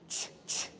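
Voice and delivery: ch whispered with no voice at all; unvoiced ch demo. Shown as a demonstration. Teacher contrasting ch with voiced j. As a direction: breathe out ch, voiceless. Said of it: "do G".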